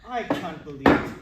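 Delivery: loud slow dismay; exasperated. Acted. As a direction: groan it out, appalled. Waiter recognizes them, dismayed.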